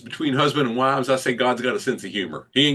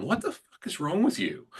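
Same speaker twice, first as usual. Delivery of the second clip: wife's line; 7 semitones higher, 6 dB quieter than usual. Retold or imitated. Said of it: "my wife says that all the time".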